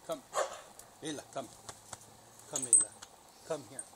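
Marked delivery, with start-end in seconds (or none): none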